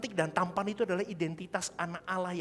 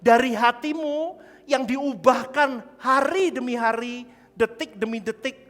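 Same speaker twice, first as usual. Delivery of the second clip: loud and slow, each phrase leaned on; building sermon climax; slow emphatic preaching; 5 semitones higher, 7 dB louder than usual. Real, not acted. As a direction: drive the point home slowly.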